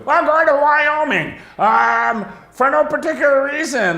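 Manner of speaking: high, slow, strained nervous voice; a rattled traveller making up a story on the spot; nervous fake alibi. Traveller lying to the border guard, high and slow. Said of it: "It's like fucking".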